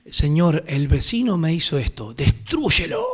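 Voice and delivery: pleading prayer that jumps way up on destrúyelo; imitating a churchgoer praying for the neighbour's ruin; mock vengeful prayer. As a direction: ham up a petty believer begging God for revenge. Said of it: "agarran un salmo y dicen".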